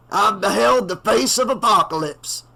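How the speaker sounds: prophesy death to the crowd; doom-saying preacher. A narrator's reading. The blinded reverend warns the townies, prophetic.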